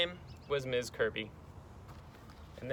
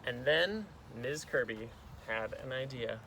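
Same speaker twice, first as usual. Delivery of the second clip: ordinary delivery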